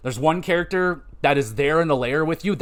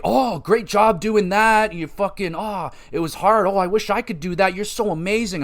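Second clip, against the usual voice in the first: higher, lightly hammed-up admiration; mock gushing praise; 5 semitones higher than usual. Characters congratulate player on final boss, mock gushing.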